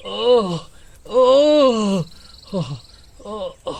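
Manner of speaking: pain cries. Acted. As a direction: cry out as the head splits. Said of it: "दर्द से फटा जा रहा है". (दर्द से कराहना और चीखना)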